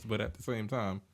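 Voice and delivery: usual speaking voice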